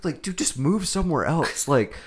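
exasperated disbelief. Retold or imitated. Cop movie fan ranting at the hero's endless court cases, exasperated. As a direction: spell out the outrage word by word. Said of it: "I'm like".

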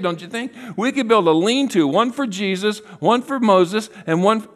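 high-pitched, gushing enthusiasm; Peter. Acted. Peter pitching a lean-to to John, high and excited. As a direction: babble on eagerly without thinking. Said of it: "starts to speak without thinking".

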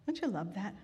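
ordinary talk, nothing marked

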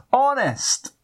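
high mock-pleading voice; pleading local. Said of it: "they plead".

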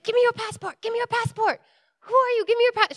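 high, loud, frantic demands; landlady flipping out. Retold or imitated. Landlady confronting a guest on the stairs, flipping out. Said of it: "she's going like this".

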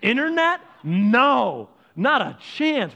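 high, loud question then a long loud no; emphatic refusal. Acted. Preacher recounts the couple cutting expenses, loudly emphatic. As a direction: call out the item and shoot it down. Acts out drastic cost cutting.

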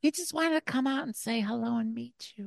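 very high falsetto, softening as it goes. Acted. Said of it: "And [name] was like".

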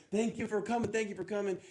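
high, eager thank-you, pitch well up; overjoyed thanks. Retold or imitated. Rescued castaway thanks the ship's crew, high and overjoyed. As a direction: gush with relief at your rescuers.

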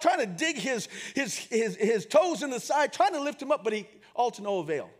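normal talking voice

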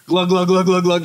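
quick loud glug-glug-glug; glugging noise. Retold or imitated. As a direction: imitate chugging a drink in one go. Acts out chugging the water.

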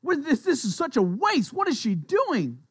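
high-pitched mock indignation; scolding. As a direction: act out scolding her for waste. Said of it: "They scolded her".

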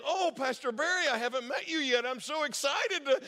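high, hammed-up excitement; mock eagerness. Prankster staffer strings the scammer along, mock eager. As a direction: play the overeager new colleague. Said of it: "he decided to have some fun with it".

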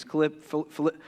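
real stutter, stuck on the name; repeated false starts on Philipp-. Real, not acted. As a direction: trip over the word from excitement. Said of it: "I'm stuttering a lot".

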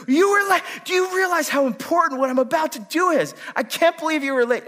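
high, loud exasperated yell; husband scolding his wife, a re-enacted high yell; retold angry outburst. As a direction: blast her for being late. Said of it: "I've lost patience, and I'm kinda letting her have it".

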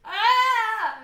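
sudden loud yelp at the screen; watching a guy fall in a video, yelps in surprise; startled cry. Real, not acted. Startled cry.

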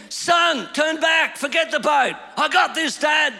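loud, high desperate shout; father shouting to his son in the water; yelling. Retold or imitated. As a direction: yell him back from the shore. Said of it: "I yelled out again".

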